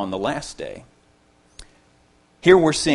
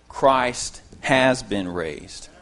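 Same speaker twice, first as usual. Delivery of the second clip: very slow, every word of "Christ has been raised" given weight; strong emphasis; much slower than usual. Shown as a demonstration. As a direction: spell it out slowly, pressing on "has". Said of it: "express this with enough emphasis".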